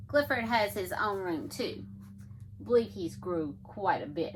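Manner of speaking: plain reading voice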